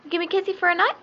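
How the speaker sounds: high, sing-song coaxing. Real, not acted. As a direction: coax the bird sweetly. Coaxing the bird for a kiss.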